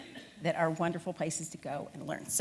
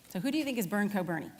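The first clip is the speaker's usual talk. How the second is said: ordinary delivery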